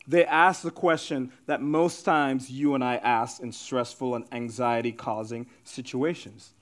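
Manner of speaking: normal talking voice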